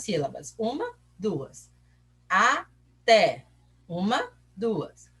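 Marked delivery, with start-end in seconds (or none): 2.3-3.5 "Até" split into a and té, with a gap; syllable by syllable. Shown as a demonstration. Teacher helps pupils count syllables, "Até" said in two separate parts.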